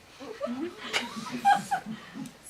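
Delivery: high-pitched burst of laughter. Acted. Storyteller breaking up over the bad kisser remark, high laughter. Mimics reaction in dramatic monologue.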